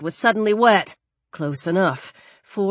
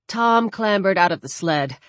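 usual speaking voice

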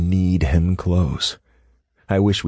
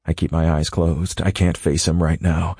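ordinary delivery

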